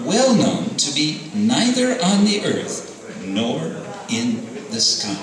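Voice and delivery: Nrsingadev's voice. Acted. Nrsingadev speaks to the demon.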